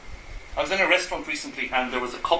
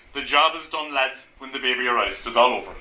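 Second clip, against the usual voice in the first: Irish dad; 5 semitones lower than usual. Retold or imitated. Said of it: "particularly in lots of Irish families".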